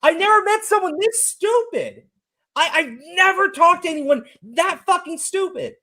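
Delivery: can't believe how dumb this is; stunned disbelief. Real, not acted. Venting to his guest about the streamer, stunned and high. Stunned outburst after laugh.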